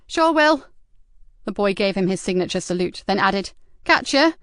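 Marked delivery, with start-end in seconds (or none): 0.1-0.7 higher, louder cheeky lad; lightly voiced streetwise teenager; teen lad voice. Mickey's voice. 3.8-4.3 higher, louder cheeky lad; lightly voiced streetwise teenager; teen lad voice. Mickey's voice.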